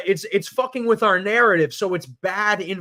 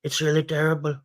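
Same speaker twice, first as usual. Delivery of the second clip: Trump impression; 4 semitones lower, faster than usual. Trump repetition.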